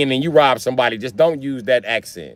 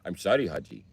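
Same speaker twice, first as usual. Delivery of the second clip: softer, apologetic mock Indian accent; 3 semitones lower, 8 dB quieter than usual. Robber apologizing to Haji, soft mock Indian accent. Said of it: "getting robbed by an Indian guy".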